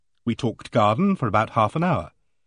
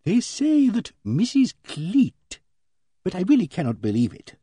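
confiding Emily Barton; 7 semitones higher than usual. A narrator's reading. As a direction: share gossip reluctantly, drawing out the first words. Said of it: "Emily Barton lowered her voice".

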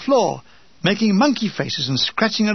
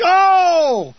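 higher, louder sudden yelp of pain; 9 semitones higher, 5 dB louder than usual. Acted. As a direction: yelp as the lorry jolts. Jolted in the lorry.

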